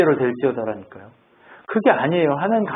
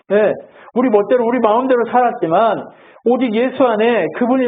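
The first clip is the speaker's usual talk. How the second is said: raised-pitch, driving sermon delivery; fervent preaching; 6 semitones higher than usual. Real, not acted. Preacher assuring the congregation of hope in Christ, fervent preaching. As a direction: proclaim it to the congregation in long surging phrases.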